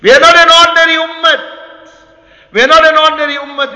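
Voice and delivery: loud, high declaration; rallying cry. Real, not acted. Preacher rousing the ummah, loud and impassioned. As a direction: proclaim it at full voice.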